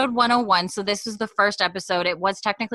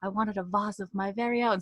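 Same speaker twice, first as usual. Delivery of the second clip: soft, quiet imitation of a British accent; airy British voiceover; light British accent; 6 dB quieter than usual. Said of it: "Her accent is messing me up".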